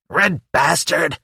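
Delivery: slow, high screeching imp curse; imp screams. A narrator's reading. Imp cursing Hellboy, slow high screech. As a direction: spit the insult as a little demon. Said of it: "the imp screams".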